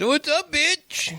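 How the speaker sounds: high, slow, drawn-out mock call; imitated cocky son. Parent imitates the son coming out of his room, cocky call. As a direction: stretch it out high like a teen's greeting.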